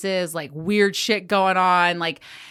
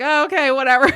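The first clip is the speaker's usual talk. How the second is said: dismissive shrug; 7 semitones higher, 7 dB louder than usual. Retold or imitated. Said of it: "And I was like".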